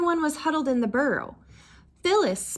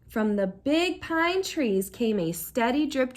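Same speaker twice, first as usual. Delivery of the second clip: ordinary delivery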